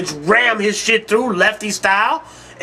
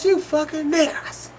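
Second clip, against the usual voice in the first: imitating the driver; 7 semitones higher, 5 dB quieter than usual. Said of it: "He goes".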